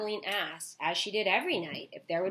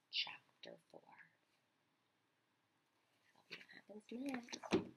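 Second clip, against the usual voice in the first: whispered; 5 semitones higher, 12 dB quieter than usual. Real, not acted. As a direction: whisper it softly. Closing the reading.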